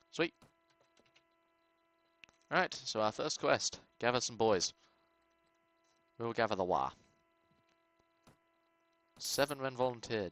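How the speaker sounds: ordinary talk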